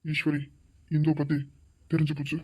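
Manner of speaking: urgent hush to his wife; hushed warning. Acted. Husband secretly warns Eswari, hushed and tense.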